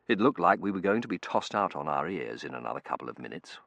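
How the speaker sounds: ordinary delivery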